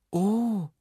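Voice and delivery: high, startled little oh. A narrator's reading. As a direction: let out a high note of dismay. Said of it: "sagte Herr Jaromir erschrocken". (erschrocken, mit hoher Stimme)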